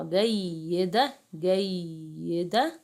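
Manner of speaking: جيدة slowly with a long doubled y; doubled letter. Shown as a demonstration. Teacher showing pupils a shadda, held double consonant. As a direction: linger on the yy of جيدة. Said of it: "كلمة فيها حرف مشدد".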